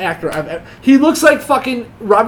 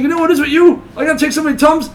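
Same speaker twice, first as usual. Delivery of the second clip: put-on whiny old cop; 7 semitones higher, faster than usual. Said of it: "He's like".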